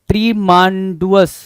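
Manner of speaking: ट्रीमांडुअस slowly as "tree-maan-du-us"; misspelling read out. Shown as a demonstration. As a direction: sound out ट्रीमांडुअस with long "maan" and extra "u". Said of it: "ट्रीमेंडस बनने की कोशिश में वो गड़बड़ हो गया".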